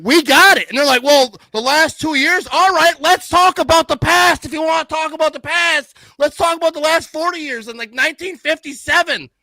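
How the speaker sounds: high, loud sports-fan rant; heated rant. Real, not acted. Fan ranting back at boasting Lions fans, loud and heated. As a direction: throw the argument back, loud and high-pitched.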